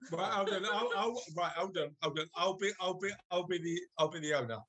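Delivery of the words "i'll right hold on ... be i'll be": ordinary talk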